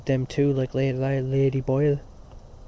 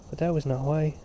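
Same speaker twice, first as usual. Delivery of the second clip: usual speaking voice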